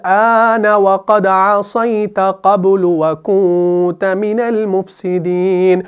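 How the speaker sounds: slow Arabic verse chant; Quran recitation. Shown as a demonstration. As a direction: recite the verse slowly and evenly.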